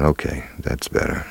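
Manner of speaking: whispered on the out-breath after a big gulp of air; relieved whisper. Retold or imitated. Actor telling interviewer, relieved whisper after finally getting air. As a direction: let it out with relief. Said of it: "I took a big breath and went".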